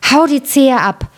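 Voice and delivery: lightly voiced commanding mother, pitch lifted; mother's command. A narrator's reading. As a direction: urge it as a storybook mother. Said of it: "die Mutter ... sprach".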